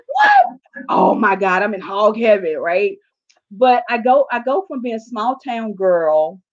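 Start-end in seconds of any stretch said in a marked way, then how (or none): 0.1-0.6 squealing high what; a delighted, disbelieving yelp; shocked what. Retold or imitated. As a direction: driver thrilled by a higher speed limit, squealed. Retold shock at speed limit.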